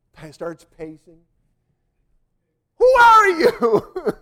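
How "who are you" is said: high, loud, slow cry with a laugh; startled shout. Retold or imitated. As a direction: blurt it out in alarm. Prisoner shouts at preacher.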